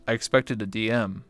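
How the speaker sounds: ordinary delivery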